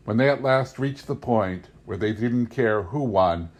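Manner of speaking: ordinary delivery, nothing marked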